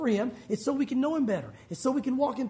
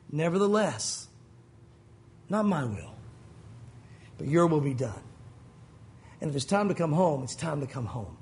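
low-pitched, resigned prayer with long pauses; calm surrender; 7 semitones lower than usual. Acted. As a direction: yield to God, sinking low. Acts surrender at prayer's end.

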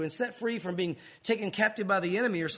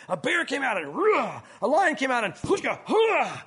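growly battle roars, higher and louder; comic reenactment, voice swooping up on each roar; hammed-up fight noises; 3 semitones higher, 4 dB louder than usual. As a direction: act out the beasts and blows with loud mock roars. Acting out David's fight speech.

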